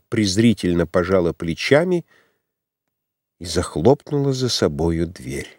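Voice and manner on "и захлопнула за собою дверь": plain reading voice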